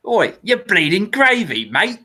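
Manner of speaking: high, slow Australian heckle; mock Australian taunt, stretched out; put-on Aussie gamer-character voice; TF2 Sniper. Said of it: "that's definitely the sniper from".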